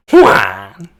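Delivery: loud, low honk of the name; Old Bag. Shown as a demonstration. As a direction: honk it like a horn. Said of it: "in Old Beg's voice".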